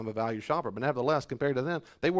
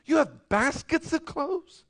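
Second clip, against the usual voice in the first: much higher, slow incredulous question; incredulous rebuke; 13 semitones higher, 5 dB louder, slower than usual. Acted. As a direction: stretch it out in mock disbelief. Preacher rebukes the complainers.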